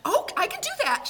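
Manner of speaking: eager and high; bright eager quote. Retold or imitated. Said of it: "she would say".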